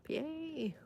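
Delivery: high, happy little cheer; delighted yay. Real, not acted. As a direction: squeal with joy at the tulip. Spotting her first tulip bloom.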